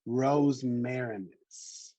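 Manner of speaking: Rosmarinus very slow, roz-MAIR-in-us, each syllable apart; slow careful model. Shown as a demonstration. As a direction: sound out Rosmarinus slowly, roz-MAIR-in-us, for learners. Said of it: "we can get through it together".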